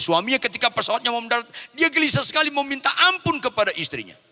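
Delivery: high, loud, urgent storytelling; forceful preaching. Acted. Preacher telling of husband seeking his wife, high urgent preaching. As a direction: drive the suspense at full force. Preacher builds the reunion climax.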